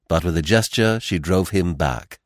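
plain reading voice

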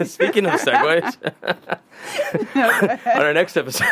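can't stop giggling mid-sentence; laughing. Real, not acted. Laughing at the segue joke.

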